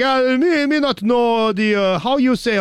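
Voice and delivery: exaggerated Indian accent, slow; broken English in an Indian accent. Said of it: "This is the strongest Indian accent".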